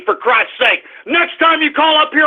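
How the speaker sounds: ordinary talk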